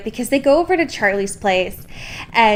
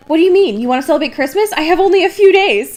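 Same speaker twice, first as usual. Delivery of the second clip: high, loud indignant whine; hammed-up panic over a short deadline; mocking Edward; 7 semitones higher, 5 dB louder, faster than usual. Edward protesting to Bella about Christmas, high and loud. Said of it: "Edward's like".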